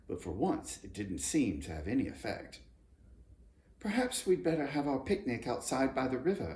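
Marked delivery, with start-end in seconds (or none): 3.8-6.5 put-on higher girl's voice; lightly voiced girl. A narrator's reading. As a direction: suggest it gently. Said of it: "said Judy".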